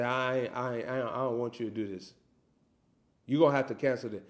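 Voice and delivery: low, very soft, understated command; Holy Spirit's voice. Acted. Holy Spirit telling the host to cancel, quietly. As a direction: drop to a quiet, firm undertone. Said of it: "the Holy Spirit say".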